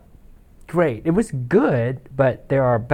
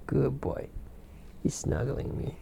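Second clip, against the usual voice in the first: soft; 12 dB quieter than usual. Real, not acted. Cat snuggled on his chest.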